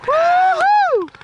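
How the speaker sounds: high whooping cheer; excited whoop. Acted. Yanks out the pain, cheering.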